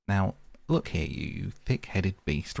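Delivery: slow, measured anger; understated, deliberate scolding; angry. A narrator's reading. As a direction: tell off a stubborn friend firmly. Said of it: "really angry".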